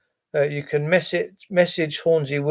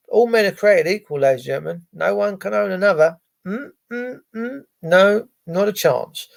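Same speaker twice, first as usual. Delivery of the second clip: ordinary talk, nothing marked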